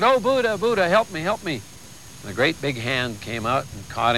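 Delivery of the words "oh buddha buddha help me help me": panicked plea. Retold or imitated. Falling parachutist crying out to Buddha, frantic plea. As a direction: beg Buddha like you're falling. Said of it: "he was screaming and yelling for some kind of help".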